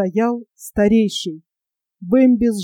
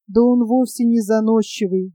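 ordinary delivery

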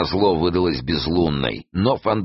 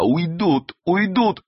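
high, tearful-sounding wail; sobbing; 13 semitones higher, much slower than usual. A narrator's reading. Саадат fears they will escape, sobbing. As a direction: whimper it high, in despair. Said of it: "Она всхлипывала".

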